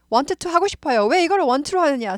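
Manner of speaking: annoyed student griping about pronunciation; mock annoyed learner. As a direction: play a fed-up beginner complaining. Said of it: "승질 나요".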